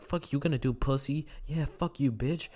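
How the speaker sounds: mock yelling. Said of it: "we start yelling at him".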